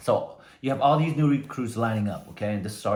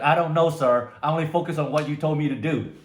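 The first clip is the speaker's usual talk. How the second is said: higher, louder shouted answer; recruit shouting; 5 semitones higher, 5 dB louder than usual. Retold or imitated. Recruit shouts his answer back to the sergeant. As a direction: bellow the answer at attention.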